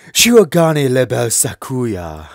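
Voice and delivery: slow, haughty name announcement; deep regal voice. Acted. Transfer student grandly gives his name, regal voice. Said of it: "a deep regal voice".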